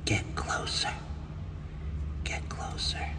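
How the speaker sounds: soft retold whisper. Hanger whispering to the designer to come closer, hushed. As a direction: lean in and whisper it.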